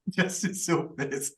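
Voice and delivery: breaking into laughter while talking; slow, laughing remark; amused laughing. Real, not acted. Host teasing an annoyed co-host, laughing.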